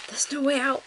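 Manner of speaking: high-pitched little bear's wail; distressed cry. A narrator's reading. Little bear finding the doors shut, distressed cry. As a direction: cry out in mild panic. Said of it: "cried the little bear".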